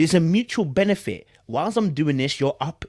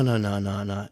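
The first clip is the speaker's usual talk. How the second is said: ordinary talk, nothing marked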